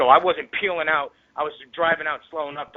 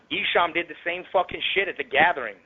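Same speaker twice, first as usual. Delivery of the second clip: ordinary talk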